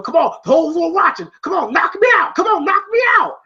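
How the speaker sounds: high-pitched mock shouting, building; goading taunt. Rocky daring Clubber to hit, goading. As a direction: dare the opponent to swing.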